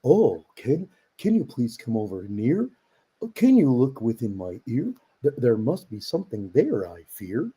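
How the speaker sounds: swooping, put-on fretful character; storybook character voice. Shown as a demonstration. Dad performing a Dr. Seuss character who cannot hear, fretful swooping voice. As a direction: play up the worry in swooping sing-song. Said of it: "there's one that goes".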